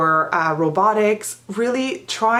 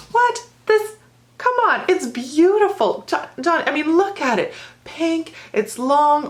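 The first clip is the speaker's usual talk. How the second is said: pitched way up, mock gushing praise; playful teasing; 9 semitones higher than usual. Teacher teasing her student about his pink shirt, mock gushing. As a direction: oversell the pink shirt to get a smirk.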